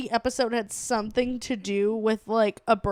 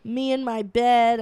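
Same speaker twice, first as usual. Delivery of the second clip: usual speaking voice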